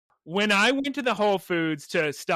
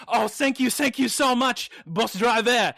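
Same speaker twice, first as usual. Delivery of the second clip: put-on higher-pitched, gushing French-accented thanks; French woman's accent; 4 semitones higher than usual. French woman thanking a bus driver, gushing French accent. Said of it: "lovely French women that get onto buses".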